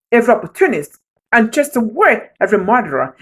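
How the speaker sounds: ordinary talk, nothing marked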